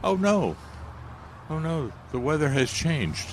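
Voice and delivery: high-pitched mock lament; mock dismay. Teasing co-host voicing gardeners upset by changed weather, mock dismay.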